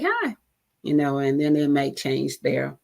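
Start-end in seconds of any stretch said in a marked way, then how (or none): 0.0-0.3 high cheerful greeting; smiling hi. Shown as a demonstration. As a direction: catch them off guard with a smile. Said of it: "put a smile in your voice".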